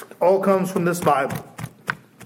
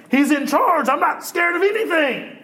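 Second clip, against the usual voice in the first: bold excitement; 9 semitones higher than usual. Real, not acted. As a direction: declare it high and fearless. Bold confident exclamation.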